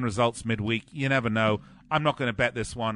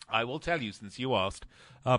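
normal talking voice

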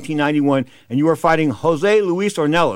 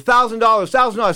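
high, louder insistent repeat; insistent demand; 7 semitones higher, 4 dB louder than usual. Retold or imitated. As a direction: hammer the demand home. Fighter insists on money.

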